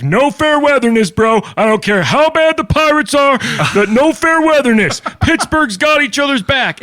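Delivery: high-pitched, hollered catchphrases; over-the-top Italian caller. Retold or imitated. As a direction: imitate a fired-up Pittsburgh superfan rallying everyone. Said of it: "over the top".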